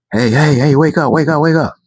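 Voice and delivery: high, urgent hey hey, wake up; urgent wake-up call. Retold or imitated. The person shaking him awake, high and urgent. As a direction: rouse a sleeping friend in a rush.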